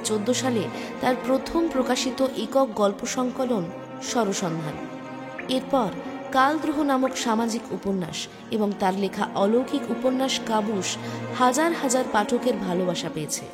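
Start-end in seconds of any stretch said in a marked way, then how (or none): none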